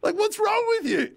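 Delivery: high-pitched, pleading retold outburst; fed-up protest climbing to a squeal; exasperated customer. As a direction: plead your case in comic exasperation. Retold fed-up outburst at bike stores.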